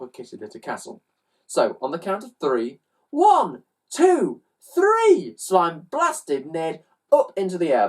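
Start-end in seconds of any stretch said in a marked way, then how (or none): 3.1-5.3 building countdown. Acted. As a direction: climb higher and louder on each number. Countdown before slime cannon launch.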